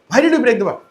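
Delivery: loud, high accusing question; a mother catching her child red-handed; angry scolding mother. Acted. Yashoda scolds Krishna.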